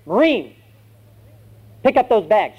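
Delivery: high, barked military order; drill sergeant. Acted. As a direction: bark a command at a recruit.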